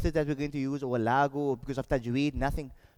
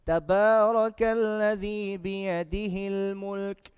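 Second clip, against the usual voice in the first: slow, sustained chanted verse; measured, melodic tajweed delivery; Quran recitation; 4 semitones higher, 3 dB louder, much slower than usual. Shown as a demonstration. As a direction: recite the phrase in one long breath with drawn vowels. Recites the whole phrase.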